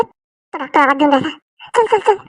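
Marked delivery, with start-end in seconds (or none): 1.8-2.2 higher, urgent chant of come on; urgent hurry. Acted. Rushing Sandeep in when the doctor calls. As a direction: push him to hurry in. Urging quickly.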